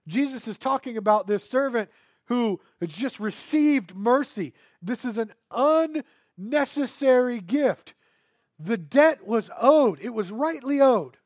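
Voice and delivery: loud, driving sermon delivery; preaching. Real, not acted. Pastor pressing the congregation about debt, loud preaching. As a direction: preach it hard to the room.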